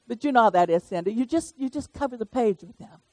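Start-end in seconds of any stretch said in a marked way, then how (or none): none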